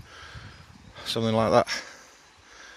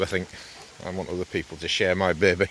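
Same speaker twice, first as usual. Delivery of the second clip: usual speaking voice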